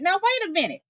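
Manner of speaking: high, indignant protest; a shocked possum, pitched high. Acted. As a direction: plead your case, stunned. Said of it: "But Bruh Possum said".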